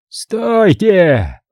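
sudden high yell to stop the shooting; a cardinal's sharp cry of stop. Acted. Cardinal yells at his guards to hold fire, high yell. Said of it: "раздался вопль кардинала".

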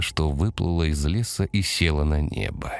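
plain reading voice

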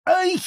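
very high yelp; sharp cry of pain. A narrator's reading. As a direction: yelp as the bee stings. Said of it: "вскрикнул он".

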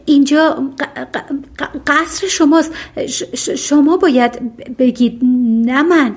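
nervous stammer, repeating the first sounds. Acted. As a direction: trip over each word like a shy girl. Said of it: "بعد با لکنت گفت".

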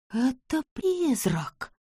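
slow, hushed terrified gasp; trembling whisper. Acted. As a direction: whisper it, shaking with fear. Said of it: "дрожащим голосом прошептала она".

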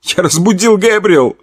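high sobbing cry. Acted. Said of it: "Он громко всхлипнул".

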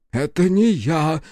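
high, slowed horrified cry; horrified denial. A narrator's reading. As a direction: recoil in horror at the accusation. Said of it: "ужаснулся Иона".

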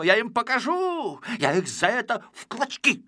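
a furious little man raging; ranting. A narrator's reading. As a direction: rant on and on in high fury. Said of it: "Он ещё долго так разорялся".

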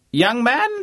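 high, loud bellow. Acted. Fake Vice-Chancellor scolding the chauffeur, loud bellow. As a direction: bark the address like an outraged don. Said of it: "bellowed James".

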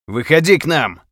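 loud, high beckoning shout; calling out. Acted. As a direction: call him out of the bushes. Calling to stranger in bushes.

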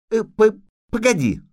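put-on high, stammering child's voice; hesitant little girl. A narrator's reading. Little princess stops departing witcher, high and stammering. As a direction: stumble into a reluctant plea.

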